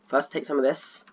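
usual speaking voice

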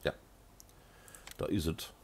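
ordinary talk

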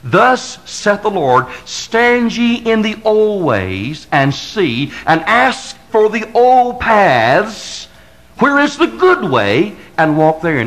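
slow solemn Bible reading; solemn scripture reading. Acted. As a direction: intone the verse from the pulpit. Reads scripture in solemn cadence.

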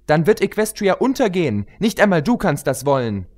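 put-on high, eager young pony voice; pony heroine. Pony heroine questioning the queen, high and urgent.

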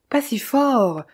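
usual speaking voice

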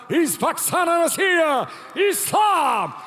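high, shouted fighter call; announcer name shout. Acted. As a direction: belt out the hometown and name. Ring announcer calls fighter name.

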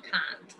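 "Can't" softly, ending on T. Shown as a demonstration. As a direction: breathe "Can't" with a final T. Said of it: "¿digo cómo?".

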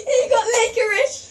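high slow shout with laughter; laugh-yell it slowly. Real, not acted.

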